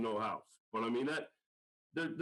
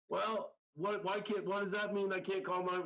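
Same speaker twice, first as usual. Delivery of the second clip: higher, exasperated confusion; retelling his own baffled reply, pitched up; 8 semitones higher than usual. Retold or imitated. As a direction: sputter it out, lost and a bit annoyed. Narrator's baffled reply to the stranger.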